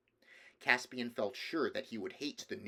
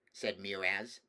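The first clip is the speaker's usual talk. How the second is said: ordinary delivery, nothing marked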